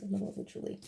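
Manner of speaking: soft awed whisper, almost no voice; a hushed gasp of delight at a card; breathless whisper. Real, not acted. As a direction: breathe it out in wonder. Admiring a pretty card.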